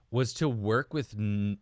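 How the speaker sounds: normal talking voice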